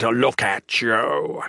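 slow, drawn-out monster voice; menacing creature. Acted. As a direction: draw the words out with slow menace. Villain figure's dragged speech.

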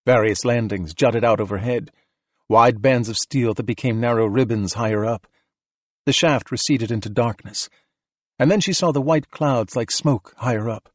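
ordinary delivery, nothing marked

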